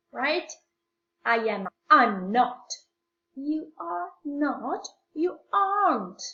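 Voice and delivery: normal talking voice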